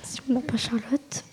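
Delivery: unvoiced whisper. Real, not acted. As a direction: whisper the aside. Whispered aside.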